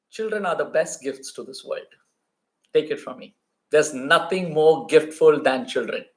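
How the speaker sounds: low, earnest closing appeal; heartfelt sincerity. Real, not acted. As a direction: drop the voice low and speak from the heart.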